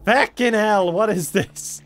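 sharp high yelled curse; loud pained curse. Real, not acted. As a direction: yell it out, hurt and annoyed. Hit again, louder outburst.

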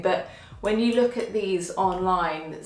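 ordinary talk, nothing marked

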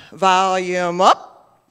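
loud and slow, turning it up; volume up. Shown as a demonstration. Leader acting out the remote's volume up for the kids, loud and slow. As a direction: crank the words up, drawn out.

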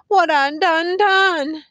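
high, loud, anguished question; acted tearful cry; crying lament. A narrator's reading. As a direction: wail it as a tearful plea. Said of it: "shaking her head and crying now".

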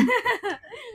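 quick high laugh; real laugh. Real, not acted. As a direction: burst out laughing. Laughs at brother's task.

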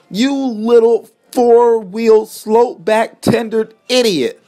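high, slow, indignant insult; angry snap. A narrator's reading. Milton covered in coal, snapping angrily at Rodney. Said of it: "snapped Milton".

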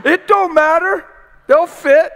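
loud high insistence; high excited shout. Retold or imitated. Billy insisting loudly that the big shoes fit. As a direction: shout it, sure they fit. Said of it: "I said".

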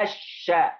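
slow الشا as "ash-shaa", silent lam and held sh; stretched "ash-shaa" for الشا with no l; doubled sh. Shown as a demonstration. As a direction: lean on the doubled sh in الشا, then open "aa". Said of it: "شدة وفتحة".

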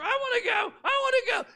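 put-on high, loud, whiny little girl; pleading to go hunting in a high kid's voice; little sister begging. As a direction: squeal it like a bratty kid.

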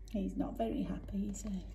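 soft quick whisper. A narrator's reading. Narrator asks children about sad Dylan, whispering. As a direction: hush it like a secret.

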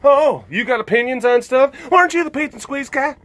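high, loud, quick Southern heckle; pseudo-effeminate Southern accent. Acted. Heckler doubting the squeaks guy's opinions, pseudo-effeminate Southern accent. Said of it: "critiquing of this stuff in an, uh, in a pseudo-effeminate, uh, Southern accent".

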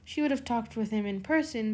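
plain reading voice

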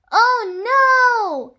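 high, loud, drawn-out cry; shouted dismay. A narrator's reading. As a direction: shout it out in storybook horror. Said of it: "shouted everyone".

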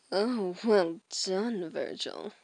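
ordinary delivery

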